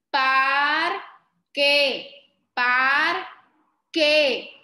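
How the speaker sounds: "parque" split into PAR... KE; loud, deliberate sounding-out of "parque"; syllable by syllable. Shown as a demonstration. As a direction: hold PAR, pause, then KE in "parque". Teacher sounds out word by syllables.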